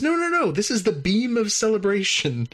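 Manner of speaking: high, chirpy alien reassurance; cheerful alien. Retold or imitated. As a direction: pitch up, breezily reassuring. Klar corrects the crew.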